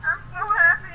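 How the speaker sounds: high, loud happy gush; bursting with happiness; excited. Real, not acted. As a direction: squeal it with joy. Gushing about new friends.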